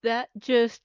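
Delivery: talking through a mouthful of chips; words round a bite of food; mouth full. Real, not acted. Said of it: "let me swallow that".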